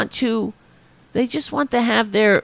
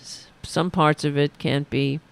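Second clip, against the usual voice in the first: ordinary talk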